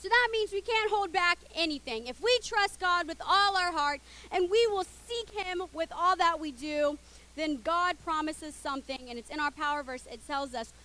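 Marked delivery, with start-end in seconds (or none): none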